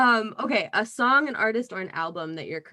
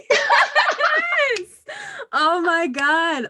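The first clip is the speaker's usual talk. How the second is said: excited; 14 semitones higher, 8 dB louder, much slower than usual. Real, not acted. Delighted by the monologue.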